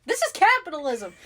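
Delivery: high, loud declaration; impassioned rant. Real, not acted. Co-host declares the villain's story is capitalism, loudly impassioned. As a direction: proclaim it like a big revelation.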